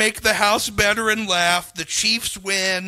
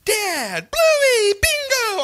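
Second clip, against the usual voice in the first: imitating kids' cartoon voices; 13 semitones higher, much slower than usual.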